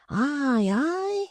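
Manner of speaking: put-on high, eager simple young man. Barnaby, high.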